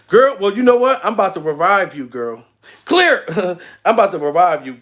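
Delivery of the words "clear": sudden high call; mock paramedic shout. Pretending to revive girl who is dead laughing, mock shout. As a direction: call it out like a pretend defibrillator shock.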